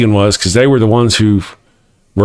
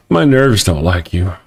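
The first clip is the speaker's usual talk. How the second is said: ordinary delivery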